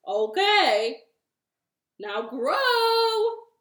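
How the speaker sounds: loud order rising high on the last word; shouted command. Acted. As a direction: bellow it at the seeds. Toad commands seeds after watering.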